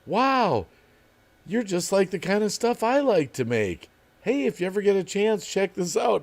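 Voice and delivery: high, sugary fake-fan voice; sneaky fan comment. Voicing his old sneaky comment to a big YouTuber, in a high fake-fan gush. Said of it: "I'd be like".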